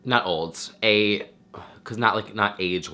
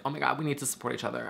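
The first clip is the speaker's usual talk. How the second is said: higher, softer, very fast mock gush; mock supportive gushing; 5 semitones higher, 6 dB quieter, much faster than usual. Streamer parodying herself preaching mutual support, quick mock gushing. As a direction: rattle it off high and light, mock-earnest. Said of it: "talking about being like".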